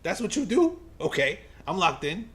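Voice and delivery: mock batter talking back to the pitcher; confused batter. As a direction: play the stunned hitter in a squeaky put-on voice. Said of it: "he's looking at, at McLean like".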